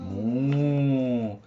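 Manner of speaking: long drawn-out moo; cow moo. Shown as a demonstration. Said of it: "What does the cow say?".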